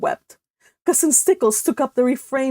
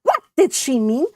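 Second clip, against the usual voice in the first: ordinary delivery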